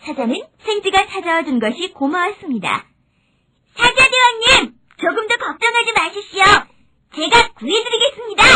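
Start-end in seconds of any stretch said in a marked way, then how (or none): 3.8-8.4 high-pitched little mouse voice; eager helpful mouse. Acted. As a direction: confidently offer rescue in a high voice. Mouse reassures trapped lion. (높은 목소리로 씩씩한 생쥐)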